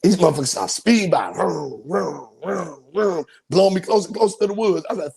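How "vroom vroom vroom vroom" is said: passing-car vrooms. Retold or imitated. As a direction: imitate cars rushing past. Imitates cars speeding by.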